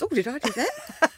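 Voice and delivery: lightly voiced caught-out dog; sheepish dog. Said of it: "You know when a dog farts and it goes".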